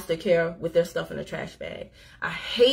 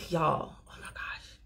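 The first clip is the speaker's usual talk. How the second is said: hushed, slower, breaking off in a whisper; whispered aside; 5 semitones lower, 8 dB quieter, slower than usual. Real, not acted. Overwhelmed speaker whispering to viewers, hushed. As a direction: let it drop to a moved whisper.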